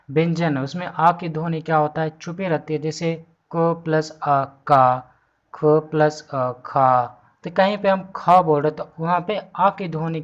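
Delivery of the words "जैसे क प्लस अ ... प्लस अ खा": "क प्लस अ का" stretched out very slowly, sound by sound; slow sound demo. Shown as a demonstration. Teacher shows students how consonant and vowel join, very slow. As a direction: spell out "ख प्लस अ खा" slowly and deliberately. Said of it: "shows sounds combining".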